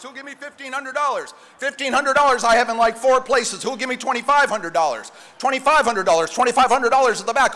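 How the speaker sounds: fast rhythmic auction call; auctioneer. Acted. Live charity auction bidding.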